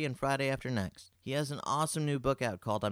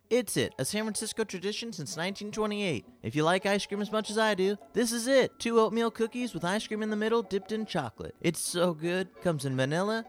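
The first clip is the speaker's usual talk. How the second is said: upbeat, higher ad announcer voice; ad read; 9 semitones higher, 3 dB louder than usual. Acted. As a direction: sell it like a radio spot. Sponsor ad read.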